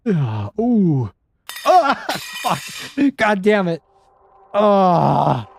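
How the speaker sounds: high, loud yelps with a laugh caught in; startled scream. Real, not acted. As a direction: jump scare panic. Monster catches her, startled.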